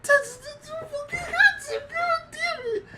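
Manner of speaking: hammed-up crying shout, very high; overdone sobbing yell at the screen; mock anguished wail. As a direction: wail it like a heartbroken fan. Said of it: "And I'm like".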